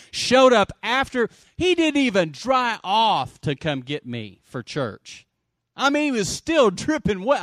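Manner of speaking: loud, high, punched storytelling; emphatic preaching. Real, not acted. Preacher tells church about his dripping friend, emphatic preaching. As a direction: preach it out with amazement.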